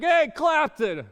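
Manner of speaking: high, loud mimicked holler; yelling. Homeless man greeting his friend downtown, imitated yell. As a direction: call out big and drawn-out, imitating his greeting. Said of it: "he yells at me".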